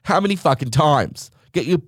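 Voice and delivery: high, snappy rude airport agent; mock a rude security officer, pitch up and bark; sarcastic high-pitched airport security rant; rude LAX agent. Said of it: "it's like".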